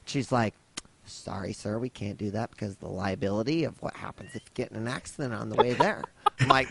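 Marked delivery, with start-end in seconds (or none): 1.0-6.0 clerk voice, lower and much softer, quick. Retold or imitated. Customer service clerk refusing to rent a car, low and soft.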